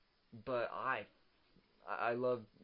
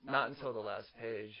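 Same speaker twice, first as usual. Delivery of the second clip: usual speaking voice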